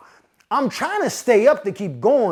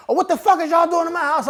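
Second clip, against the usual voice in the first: high, fast angry mom voice; mock outraged mother; 9 semitones higher, faster than usual. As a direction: burst in scolding like a mother, pitched way up. Angry mother bursts in, acted.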